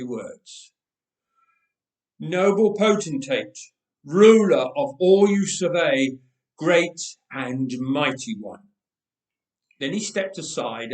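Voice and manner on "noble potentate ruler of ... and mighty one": loud, ceremonious flattery to a king; grand formal address. Acted. Ehud greets the tyrant king.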